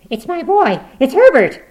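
high, loud frantic mother; frantic old woman's shout; crying out. A narrator's reading. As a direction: cry out wildly for your son. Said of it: "she cried".

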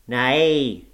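loud, drawn-out snarl through the nose; snarling nasal scream. A narrator's reading. Old servant refusing the narrator's question, snarling nasal scream. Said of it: "he snarled, or rather screamed through his nose".

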